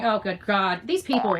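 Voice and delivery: ordinary talk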